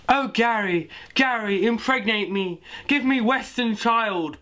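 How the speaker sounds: high, slow mock purr; purring prostitute. Prostitute purring at Gary, high and hammed-up. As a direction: coo it up high and drag the last line. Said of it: "purred the prostitute".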